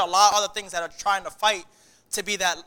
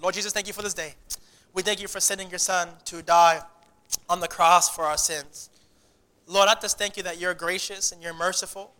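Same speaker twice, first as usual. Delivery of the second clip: closing prayer. Acted.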